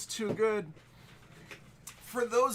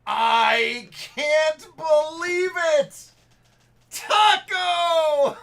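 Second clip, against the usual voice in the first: a huge, high, slow disbelieving yell, then a shouted name; real shock and joy, full voice; ecstatic shout; 9 semitones higher, 14 dB louder, much slower than usual. Real, not acted. Streamer shouting as a friend's joke card comes up.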